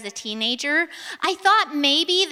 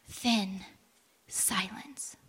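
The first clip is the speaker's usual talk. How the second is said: thin, whispered hush; 6 semitones lower, 13 dB quieter, much slower than usual. Shown as a demonstration. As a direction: drop to a breathless whisper on silence. Describes God's thin silence.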